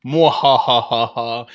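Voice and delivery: theatrical villain laugh; evil cackle. Acted. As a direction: cackle like a horror host. Spooky Halloween villain intro.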